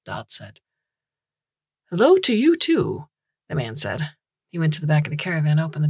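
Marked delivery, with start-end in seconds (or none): 1.9-3.1 high, loud, cheery hello; cheerful greeting. A narrator's reading. Small man greets a stranger at his caravan, cheerful. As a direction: greet them brightly, pitched up and louder. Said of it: "smiled at him cheerfully".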